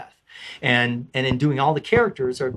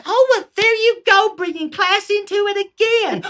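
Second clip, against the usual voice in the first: put-on high, loud exasperated old woman; hammed-up old lady; 5 dB louder than usual. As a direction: scold like a fed-up crone. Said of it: "comes the old lady".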